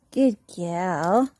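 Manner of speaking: low, gentle praise; warm praise. Real, not acted. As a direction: speak it gently to the dog. Praising dog after whisper.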